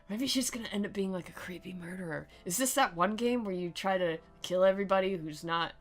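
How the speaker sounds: ordinary talk, nothing marked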